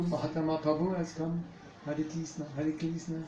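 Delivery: imitating Chinese-accented English; lightly put-on Chinese accent. Teacher imitating his welcome in China, Chinese accent. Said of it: "I was just in China".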